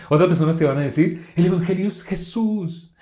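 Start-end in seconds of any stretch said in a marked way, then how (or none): 1.3-2.9 slow, higher, pious sing-song; mock spiritual voice. Preacher mocking churchgoers' pious answers, mock spiritual voice. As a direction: parody a churchgoer sounding holy. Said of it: "un timbre de voz que suene como espiritual".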